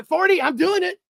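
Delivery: high and loud, thrilled; excited. Real, not acted. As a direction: celebrate hitting forty while dancing. Reaches forty likes, dancing.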